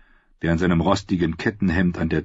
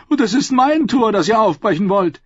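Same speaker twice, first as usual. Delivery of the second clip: protesting; 7 dB louder than usual. Acted. Lord Ammon protesting to the council, high and indignant. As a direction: claim the walls as his, affronted. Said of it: "protestierte Lord Ammon".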